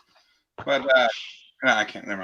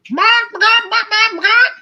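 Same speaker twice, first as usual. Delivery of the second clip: squeaky, much higher, louder yapping barks; chicken barking; 8 dB louder than usual. Retold or imitated. Chicken orders from the bartender with much higher, louder mock barks. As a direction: yap it out like a silly cartoon animal. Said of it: "the first chicken says to the bartender".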